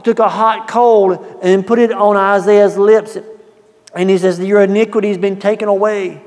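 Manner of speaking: high, loud impassioned preaching; preaching fervor. Acted. Preacher to congregation, high and loud. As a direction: carry the room with fervor.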